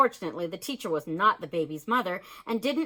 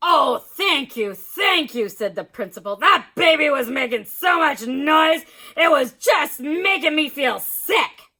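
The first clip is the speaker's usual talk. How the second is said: relieved principal; 5 semitones higher, 9 dB louder than usual. A narrator's reading.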